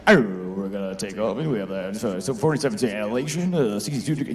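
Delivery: pilot voice. Retold or imitated. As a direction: imitate a laid-back airline pilot. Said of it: "you know the pilot voice they do".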